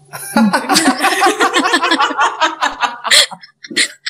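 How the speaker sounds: bright burst of laughter; laughing. Real, not acted. Teacher laughing at the student's name. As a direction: laugh out loud at the odd name.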